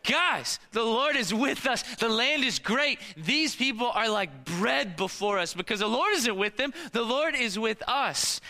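rally the crowd with total confidence; fired-up speech. Acted. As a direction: Joshua and Caleb rallying the people, fired up. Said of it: "So they hear this speech".

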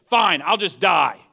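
loud, slow defiant give-up; angry surrender. Acted. Jonah refusing to pray, angrily giving up. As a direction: give up loudly and crossly. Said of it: "He's angry".

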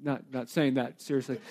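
chuckling it off; light laugh through words; laughing aside. Real, not acted. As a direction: laugh at the joke. Laughs at own joke.

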